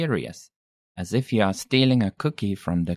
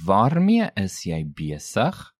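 slow "Waarmee is jy besig?" rising on "besig"; slow, rising question. Shown as a demonstration. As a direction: space out "Waarmee is jy besig?", lifting the end of "besig". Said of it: "Note again the rising intonation".

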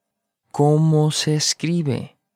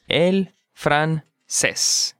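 slow model; much slower than usual. Shown as a demonstration. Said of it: "slow model".

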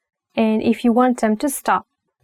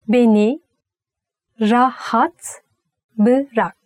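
slow split; slower than usual. Shown as a demonstration. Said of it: "slow teaching split".

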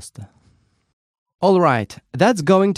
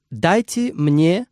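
slow model phrase; 2 semitones higher, slower than usual. Shown as a demonstration. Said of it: "You can simply say".

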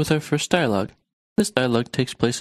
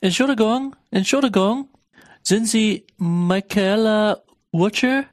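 raised, high call to a stranger; 8 semitones higher, slower than usual. Acted. Traveller calling out to a stranger at the airport, raised and high. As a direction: call out across the arrivals hall.

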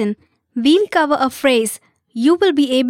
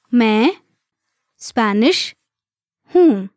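separated words, evenly spaced for learners; much faster than usual. Shown as a demonstration. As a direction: say each word alone, leaving space to repeat. Teacher breaks phrase down for learners.

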